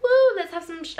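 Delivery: high, loud retold whoop; excited cheer. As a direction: cheer it like a celebration. Retold boyfriend celebrating the new flat.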